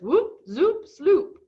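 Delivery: high, loud, bouncy whoop zoop sloop; playful, rising "Whoop, zoop, sloop"; gleeful silly sound words. Retold or imitated. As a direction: sing out "Whoop, zoop, sloop" with delight, rising on each word. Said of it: "the way he talks that cracks me up".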